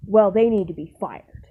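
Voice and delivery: firm, loud verdict from the office. Retold or imitated. Owner in the office loudly demanding a firing. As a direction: lay down the law, loud.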